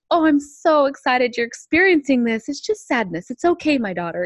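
joyful Heavenly Father. Retold or imitated. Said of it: "And he's like".

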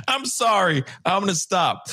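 high, apologizing through laughter; laughing apology. Real, not acted. Streamer sorry for the bad accent, laughing apology. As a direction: apologize while still cracking up.

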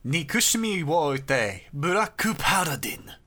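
slow, careful katakana English "burakku paladin"; Japanese title read. Shown as a demonstration. Host announcing the episode title, slow Japanese reading. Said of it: "Episode one thirty-four".

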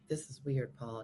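hushed aside to a neighbour; whisper. Retold or imitated. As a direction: whisper so the crew can't hear. Aside to seatmate.

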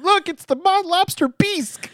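very high, loud squeal; hammed-up high-pitched thrill; mock excitement. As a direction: exclaim it like a teasing sightseer. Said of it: "we're gonna be like".

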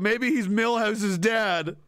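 normal talking voice

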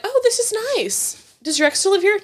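high-pitched mock visitor gushing; lightly mocked impressed guest; imitating visitors. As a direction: put on a high, cooing houseguest voice. Voicing nosy visitors.

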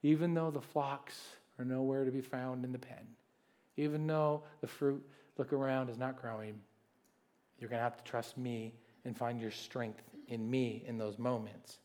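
soft, low reassurance; hushed tender promise; quiet reassuring. Acted. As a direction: speak gently as God reassuring. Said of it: "God is saying".

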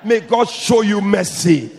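loud, slow blessing called out; preacher's slow shouted blessing. Real, not acted. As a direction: bellow the blessing slowly.

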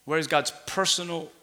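usual speaking voice

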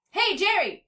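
high, loud call from a tree; yelling. A narrator's reading. Cat yelling down from the treetops. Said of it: "she yelled from the treetops".

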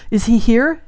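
high, urgent gasped question; gasped, repressed emotion. A narrator's reading. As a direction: gasp it, holding back emotion. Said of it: "she gasped, voice thick and wet".

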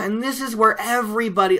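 ordinary talk, nothing marked

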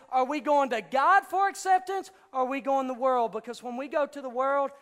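high, loud, driving preacher's delivery; full-force preaching. Acted. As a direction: hammer it home to the crowd without letting up.

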